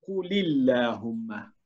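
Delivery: "قل اللهم" read slowly, the lam of "قل" given a kasra; "قُلِ اللهم" read as a careful demo; slow recitation. Shown as a demonstration. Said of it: "نقول".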